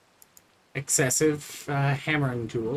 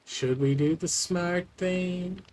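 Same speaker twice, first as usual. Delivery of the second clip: higher, slower teasing question; playful question; 6 semitones higher than usual. Pretend. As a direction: lift it up, drawn out and coy. Repeats phrase as playful question.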